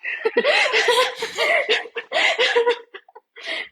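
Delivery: bursts into high laughter. Real, not acted. Laughing about parents and gym.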